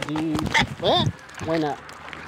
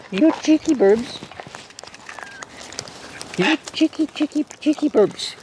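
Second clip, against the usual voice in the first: silly teasing voice; 11 semitones higher than usual. Pretend.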